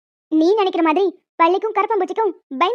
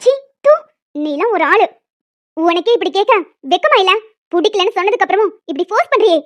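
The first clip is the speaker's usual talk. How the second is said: disgusted outburst; 4 dB louder than usual. Acted. Woman scolds Sathya in loud disgust at his demand. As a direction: spit out the words in disgust.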